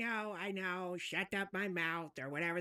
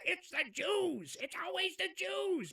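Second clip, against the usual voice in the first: high, slow mocking whine; mocking Iran; 11 semitones higher, slower than usual. Mocking Iran's complaint to friend, high sneering whine. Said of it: "I'm so tired of the".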